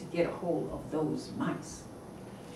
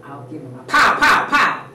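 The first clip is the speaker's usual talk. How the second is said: blustering dad; 10 dB louder than usual. Acted. Papa mouse boasts.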